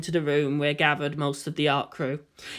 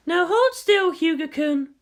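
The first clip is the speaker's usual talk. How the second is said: high, light female voice, teasing compliment; Kodama's voice; 11 semitones higher, slower than usual. Acted. Kodama to Makoto in costume, high playful approval. Kodama readies drawing pad.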